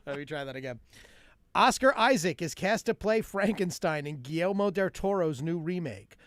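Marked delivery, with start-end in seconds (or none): 1.6-6.2 raised, punchy mock newscast; joke news read. Reading a Whole Foods union headline, punchy mock news read. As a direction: deliver the headline, then drive to the punchline.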